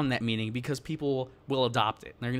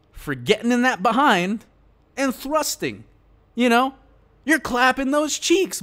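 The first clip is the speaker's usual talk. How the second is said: rising, louder, hammed-up crude glee; mock crude; 6 semitones higher, 6 dB louder than usual. Real, not acted. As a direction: pitch climbing higher and louder, hammed up. Mock-crude explanation, rising energy.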